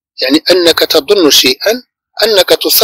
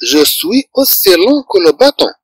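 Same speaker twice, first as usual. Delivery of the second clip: slow dictation; much slower than usual. Shown as a demonstration. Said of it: "ثم يقول الثعبان".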